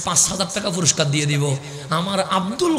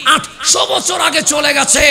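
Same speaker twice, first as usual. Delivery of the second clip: loud, rising sermon cry over music; fervent waz preacher voice; preaching at full force; 10 semitones higher, 7 dB louder than usual. Acted. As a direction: build the crowd up, calling out each line. Climactic preaching, music behind.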